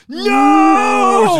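long drawn-out, high, loud mock-heroic "No!"; stretched-out dramatic yell; slow-motion shout. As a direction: holler it like a slow-motion movie rescue. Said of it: "Slow motion dive".

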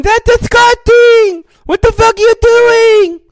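shrill disgusted squeal at him; high, loud, slow mock outrage; imitating Boo Boo. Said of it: "Boo Boo right now is going".

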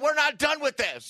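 high-pitched screamed alarm; screaming. Acted. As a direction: scream for help at an intruder. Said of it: "I will start screaming".

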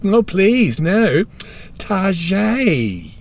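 protest, then give Target a French -zhay ending; put-on posh correction, Target as "tar-zhay"; mock-French Target. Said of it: "And he goes".

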